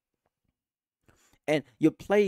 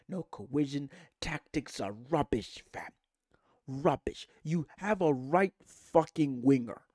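ordinary delivery

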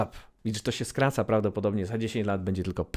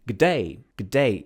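louder Australian g'day; 5 dB louder than usual. Shown as a demonstration. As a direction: greet like a caricature Aussie. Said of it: "stereotypowo będzie mówił".

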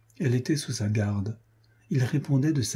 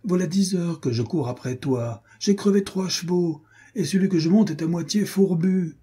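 high-pitched, worked-up protest; exasperated complaint; 8 semitones higher than usual. A narrator's reading. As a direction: pitch climbing high as the grievance builds. Exasperated friend after long chase.

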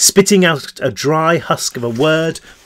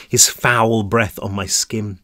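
ordinary delivery, nothing marked

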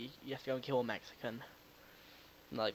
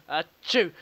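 loud fake sneeze; 3 semitones higher, 13 dB louder than usual.

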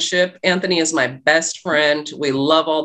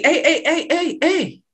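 much higher calling hey, hey; calling after her; 10 semitones higher than usual. Retold or imitated. The date calling her back in the morning, higher call. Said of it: "And he".

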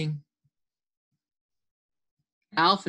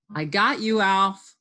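raised triumphant read-aloud shout; louder playground announcement; 2 semitones higher, 4 dB louder than usual. Acted. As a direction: call the tag out loudly. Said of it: "shouted Fritz".

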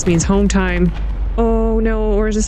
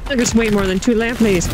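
ordinary talk, nothing marked

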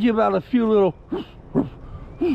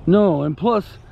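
ordinary talk

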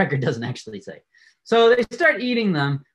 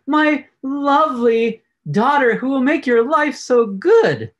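sweet-talking; 7 semitones higher, 6 dB louder than usual. Retold or imitated. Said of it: "he goes up".

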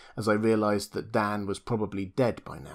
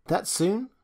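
much higher, a catch of grief; throb in her voice; 9 semitones higher than usual. Acted. Lil hearing of Dan's injection, throbbing voice. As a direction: let the voice quiver on the question. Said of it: "a throb in her voice".

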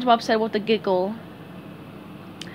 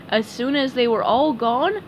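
plain reading voice

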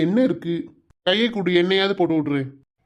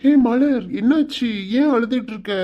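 higher-pitched, startled worry; alarmed concern; 5 semitones higher, 3 dB louder than usual. Acted. Fiancé finding his bride-to-be in tears, alarmed. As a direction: ask urgently, voice lifted in concern. Fiancé finds her crying.

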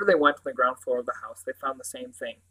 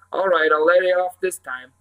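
higher, louder Cornish sergeant; warm Cornish accent; 4 semitones higher, 8 dB louder than usual. A narrator's reading. Sergeant grinning at an eager boy, warm Cornish accent. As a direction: let him off in genial Cornish. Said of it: "his warm Cornish accent stroking the words".

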